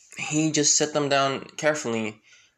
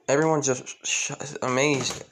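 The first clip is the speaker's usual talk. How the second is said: ordinary delivery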